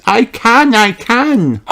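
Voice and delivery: put-on high, loud determined girl voice; little girl psyching herself up; Peppa. A narrator's reading. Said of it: "Peppa nods".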